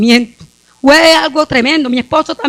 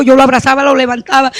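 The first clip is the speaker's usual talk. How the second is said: ordinary talk, nothing marked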